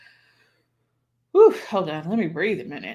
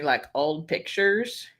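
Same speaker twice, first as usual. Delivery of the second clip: ordinary talk, nothing marked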